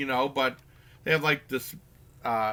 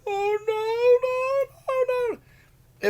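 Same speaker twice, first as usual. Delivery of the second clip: panicked falsetto no no no, much higher; coach panicking; 4 dB louder, much slower than usual. Retold or imitated. Coach watching the boy crushed, high panicked wailing. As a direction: squeal the noes in high panic. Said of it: "And then the coach like".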